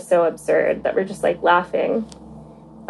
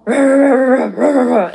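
rapid run of "ruh" barks, higher and louder; fast intense barking; 5 semitones higher, 6 dB louder than usual. Retold or imitated. Imitating the phone's frantic yelling at the caller, fast loud barks. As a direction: fire the barks off quick and hard, like yelling. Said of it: "fast and intense".

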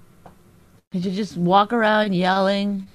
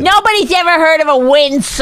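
mock yelling; 6 semitones higher, 4 dB louder than usual. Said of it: "I didn't yell like that".

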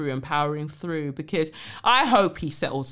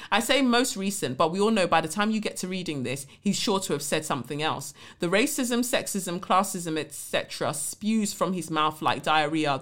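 ordinary talk, nothing marked

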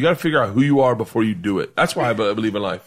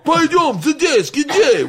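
high, raised mock Russian cop shout; cop yelling in Russian; 4 dB louder, slower than usual. Russian policeman yelling at the student, mock Russian shout. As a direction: bark the Russian words like an angry cop. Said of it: "I hear him yell".

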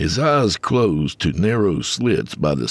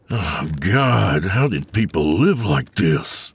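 groaning; slower than usual. A narrator's reading. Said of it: "he groaned".

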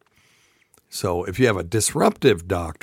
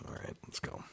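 whispering; 21 dB quieter, faster than usual. Real, not acted. Whispered in an aside.

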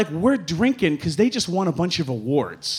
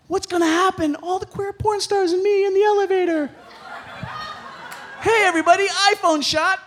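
put-on high, giddy drunk voice; 10 semitones higher than usual. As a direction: sound tipsy and thrilled. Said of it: "I'm like".

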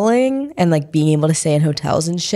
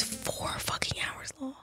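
whispering; 7 semitones higher, 19 dB quieter than usual. Real, not acted. Whispered aside about the ceremony.

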